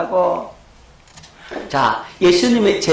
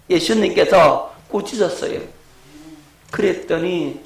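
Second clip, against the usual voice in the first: ordinary delivery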